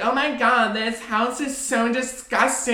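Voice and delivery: high, snooty, exaggerated disgust; mocking high voice. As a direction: camp it up, high and scornful. Mocking hosts judging a house.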